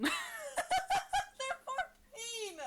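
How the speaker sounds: high, loud, very slow laughing protest; horrified laugh at the joke; shocked laughing cry. Real, not acted.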